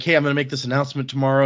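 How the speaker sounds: usual speaking voice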